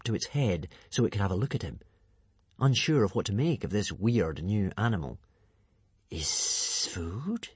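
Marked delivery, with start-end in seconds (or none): 6.1-7.5 low musing hiss of a serpent; a hissing serpent, musing to itself; hissing serpent dragon. A narrator's reading. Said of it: "hissed the serpent musingly to itself".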